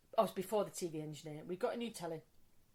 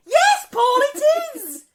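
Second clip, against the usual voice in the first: very high, loud shout; hammed-up shrieked confirmation; retold exasperation; 17 dB louder than usual. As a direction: snap back at the doubting repairman. Exasperated at being doubted by repairman.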